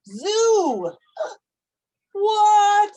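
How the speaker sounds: high, loud playful gasp and What; mock shock. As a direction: ham up the shock for the child. Mock shock at selling to zoo.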